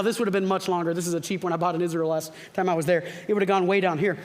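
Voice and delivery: normal talking voice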